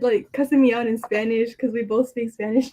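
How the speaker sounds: ordinary delivery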